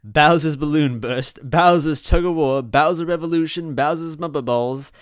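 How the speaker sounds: showy title announcements; game-show announcer. A narrator's reading. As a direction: call out each game title with fanfare. Screen options read like an announcer.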